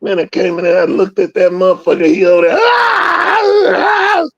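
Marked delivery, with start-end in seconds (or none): 2.6-4.3 shrill yelping cries of a beaten dog; imitated dog yelp. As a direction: cry out like a hurt dog. Dog crying out in pain.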